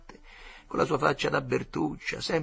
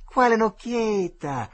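higher, louder, slower jeering repeat; jeering boy shouting the question again; mocking yell; 11 semitones higher, 7 dB louder, slower than usual. A narrator's reading. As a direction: hammer the taunt home. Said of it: "disse il Tirillo".

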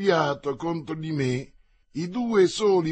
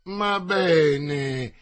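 ringing, mocking voice, a shade louder; 4 dB louder than usual. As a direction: jeer at the fallen rider. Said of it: "voce vibrante e beffarda".

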